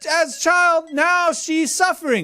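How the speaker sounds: very high, strained rant; mock yelling. Host reading an irate comment, mock yelling. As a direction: yell it in a high, hectoring voice. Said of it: "You don't have to yell at me".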